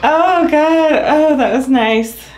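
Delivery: high, loud laugh through the words; laughing relief. Real, not acted. Patient laughing in relief after her adjustment.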